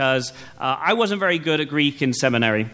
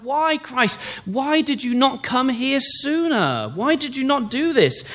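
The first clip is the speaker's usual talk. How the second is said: pleading why; 7 semitones higher than usual. Acted. Voices Mary's grief to Christ.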